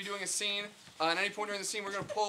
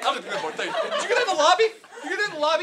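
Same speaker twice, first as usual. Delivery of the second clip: flustered; 5 semitones higher, 12 dB louder than usual. Acted. Flustered doctor with patient.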